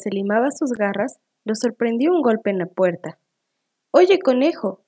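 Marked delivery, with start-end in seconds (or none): 4.0-4.8 raised, higher storybook call; louder, lifted hail. A narrator's reading. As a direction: call to someone through a door. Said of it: "gritó alguien desde afuera".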